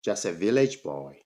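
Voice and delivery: ordinary talk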